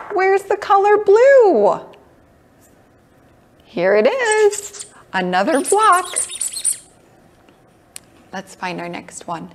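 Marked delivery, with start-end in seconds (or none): 0.1-6.1 excited. Acted. Finding block for kids.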